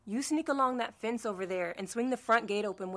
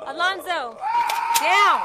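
loud urgent yell, pitched up; warning shout; 7 semitones higher, 10 dB louder, slower than usual. Acted. Warning ally in a fight.

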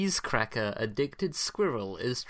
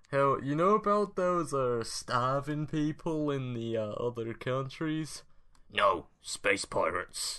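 clumsy put-on American accent, slow; a weak American accent drawl; bad American accent; 2 semitones higher, slower than usual. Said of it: "one of the worst American accents".

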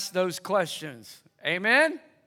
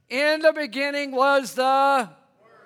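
raised-pitch preacher's recital; ringing call-and-response scripture; prompting scripture recitation; 4 semitones higher, 4 dB louder than usual. Real, not acted. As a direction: recite it so the room fills in the last word. Scripture for congregation to complete.

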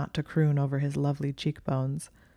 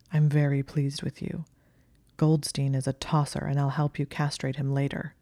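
ordinary delivery, nothing marked

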